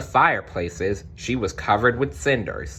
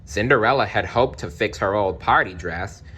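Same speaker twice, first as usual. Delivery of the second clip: ordinary delivery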